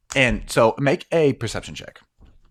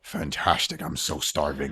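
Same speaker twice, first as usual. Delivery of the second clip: lower, softer hungry creature; hungry alien voice; 6 semitones lower, 6 dB quieter than usual. Acted. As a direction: savour the word starving, quietly. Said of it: "you hear the voice goes".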